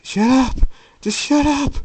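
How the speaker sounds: very high, loud outburst; desperate shout. Acted. Jacob to the voice in his head, desperate yelling. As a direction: scream it to drown out a tormentor.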